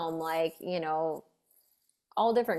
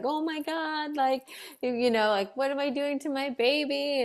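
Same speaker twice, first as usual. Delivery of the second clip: higher, mildly panicked quote; mock panic; 8 semitones higher than usual. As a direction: imitate a mild panic, voice jumping up. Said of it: "panicking on top of crying".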